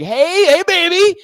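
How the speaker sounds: high, loud leering catcall; catcaller. Retold or imitated. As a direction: call out to a passing woman, high and loud. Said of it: "They'll be like".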